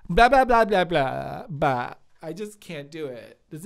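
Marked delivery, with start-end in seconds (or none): 0.1-1.9 loud flustered blah blah; flustered babbling. Retold or imitated. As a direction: babble loudly, tongue-tied. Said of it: "I'm just like".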